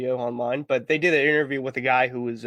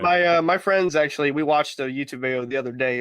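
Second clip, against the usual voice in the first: normal talking voice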